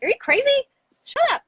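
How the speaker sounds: high, loud playful snap; mock snap. Wife snaps at her supportive husband, high and loud. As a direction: bark it at him, half joking. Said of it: "And I was like".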